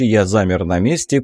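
plain reading voice

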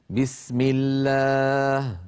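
"بسم الله" slow, with a long thin aa; light alif. Shown as a demonstration. Teacher demonstrates the light alif to students, drawn out. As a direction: draw out a fronted, light aa in "الله". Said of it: "كيف الألف في بسم الله مرققة".

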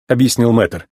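plain reading voice

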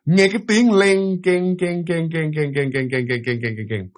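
slow clink-clink sound, dying away; imitated clinking keys. Storyteller mimics keys rolling down the stairs, fading clinks. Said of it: "Cái tiếng chìa khóa nó lăn".

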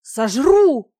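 high, very loud menacing cry; shouted threat. A narrator's reading. Captive dragoness threatening the dragon who holds her, furious shout. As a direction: hurl the threat at full voice. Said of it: "посыпались угрозы в мой адрес".

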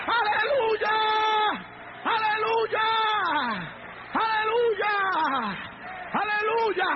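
high, sustained shouted preaching; full-force preaching. Real, not acted. Preacher rousing the church to face the devil, fervent shouting. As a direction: drive every phrase home at a high, insistent shout.

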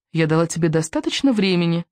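ordinary delivery, nothing marked

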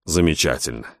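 low, quiet sarcastic praise. A narrator's reading. Robin mocks the customs beyond the strait, sarcastic. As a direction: drop the voice for mock approval. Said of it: "саркастически заметил Робин".

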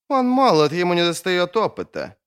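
high-pitched whiny protest; querulous whining objection. A narrator's reading. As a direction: complain in a high, plaintive voice. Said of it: "скулил Халлек".

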